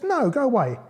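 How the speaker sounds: put-on high, loud little-girl refusal; high-pitched girl protesting; Little Red Rosenberg. A narrator's reading. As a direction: shoo the wolf off in a high voice. Girl sends wolf away.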